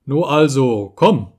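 loud, rising call to come; king summons the boy, loud. A narrator's reading. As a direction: beckon him over with a shout.

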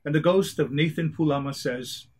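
plain reading voice

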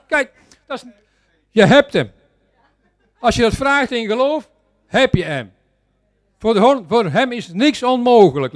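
preaching. Real, not acted. Preacher telling dear people nothing is impossible, forceful preaching. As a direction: punch out the promises to the congregation with full conviction.

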